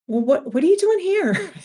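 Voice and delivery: high, loud amazed question; brightened-up local. Retold or imitated. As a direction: imitate a local thrilled by the visitor. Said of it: "they just brighten up".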